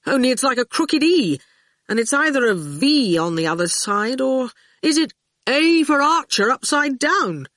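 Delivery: a father musing aloud over a scrawled letter; father amused. A narrator's reading. Father puzzling over the carved letter, lightly voiced. Father reads the goon's carving.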